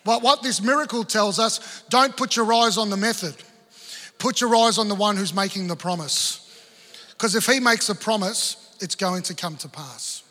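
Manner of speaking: full-force sermon delivery; preaching. Acted. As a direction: drive the point home to the congregation.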